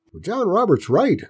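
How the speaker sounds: put-on high old man, firm; old grandpa voice. A narrator's reading. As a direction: back the boy like a stubborn old farmer.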